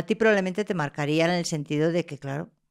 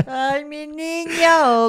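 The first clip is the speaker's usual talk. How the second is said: mock tender mother; 9 semitones higher, 4 dB louder, much slower than usual. Mother teasing son.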